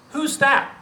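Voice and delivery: high, thin little bug voice; tiny insect voice. Acted. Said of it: "in his new tiny insect voice".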